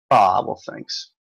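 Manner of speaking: ordinary talk, nothing marked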